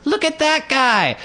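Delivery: very high, slow squeal; toddler girl. Retold or imitated. Storyteller plays a girl ignoring her mother, high toddler squeal. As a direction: imitate an insistent toddler, way up high. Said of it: "And she's like".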